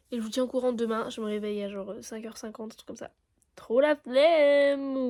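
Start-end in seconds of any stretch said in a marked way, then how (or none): 3.5-5.0 drawn-out whine. Real, not acted. Early bus complaint.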